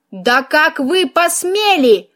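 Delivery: slow, stretched-out indignation; theatrical indignant wail, not full volume; outraged cry. A narrator's reading. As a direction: demand it in outrage, drawing out each word. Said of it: "Очередной мой вопль".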